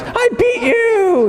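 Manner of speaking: slow, high triumphant crow; imitating son's high gloating yell; gloating kid. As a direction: crow it like a kid who won. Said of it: "He goes".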